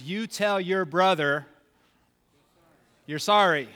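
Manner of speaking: lightly voiced parent laying down the law; slow, drawn-out command to a child; parent's order. Voicing his parent ordering an apology.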